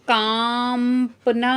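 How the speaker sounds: कांपना stretched slowly with clear nasal कां; slow demo word. Shown as a demonstration. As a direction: spell out कांपना slowly for the class. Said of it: "अगला शब्द है". (धीमा, खींचा हुआ उच्चारण)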